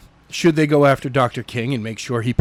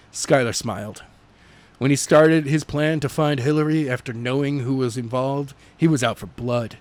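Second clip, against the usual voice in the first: usual speaking voice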